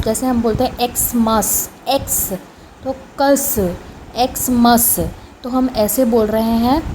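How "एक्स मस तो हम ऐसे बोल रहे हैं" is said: usual speaking voice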